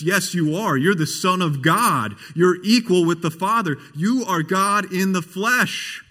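impassioned profession of faith, slowing at the end; emphatic confession. Retold or imitated. Martha's confession, paraphrased with force.

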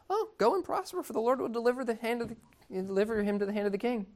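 breezily sarcastic blessing, hurried and high; the prophet mocking the king with fake approval. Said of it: "with a little bit of sarcasm".

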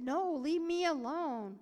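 loud, drawn-out, cross refusal; imitated anger. Sister rebuffs the preacher as a teen, angry. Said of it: "Vicki was mad".

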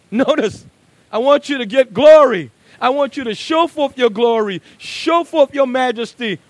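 loud, high, ringing pulpit delivery; preaching at full force. Acted. Preacher voicing the psalmist's plea, loud and ringing. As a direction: cry it out to the whole church.

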